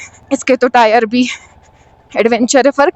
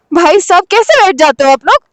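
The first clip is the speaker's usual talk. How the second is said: amazed outcry; 4 semitones higher, 5 dB louder than usual. Real, not acted. Watching bike loaded on truck.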